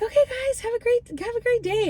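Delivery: high, sing-song mock server voice; hammed-up fake-friendly goodbye from the server; fake cheery server. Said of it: "He's like".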